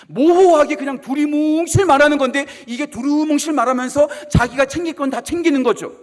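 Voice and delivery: loud, rising pulpit warning; fiery sermon delivery; full-force preaching. Acted. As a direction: hammer home the warning like a preacher.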